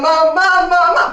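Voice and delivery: high, loud repeated calls for mom; panicked yell. Acted. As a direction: scream for mom in rapid bursts. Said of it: "and I yelled".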